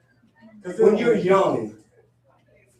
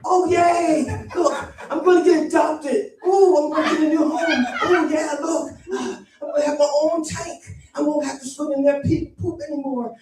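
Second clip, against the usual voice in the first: high, giddy goldfish voice; excited goldfish; 11 semitones higher than usual. Acted. Goldfish about to get adopted from pet store, overexcited. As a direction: squeal with hammed-up delight at being adopted. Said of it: "you get all excited".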